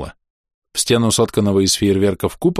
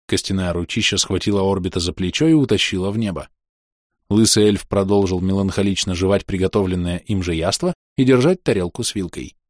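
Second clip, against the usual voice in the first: plain reading voice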